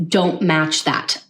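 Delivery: ordinary delivery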